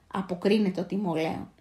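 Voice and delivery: usual speaking voice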